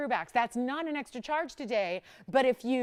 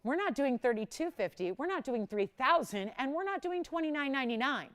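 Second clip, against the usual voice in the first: ordinary talk, nothing marked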